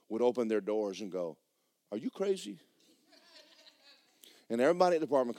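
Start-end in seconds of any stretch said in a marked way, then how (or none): 1.9-2.6 higher, softer mock disbelief; incredulous neighbour. Neighbours open doors at the singing maintenance man, mock incredulous. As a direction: imitate a baffled neighbour questioning you.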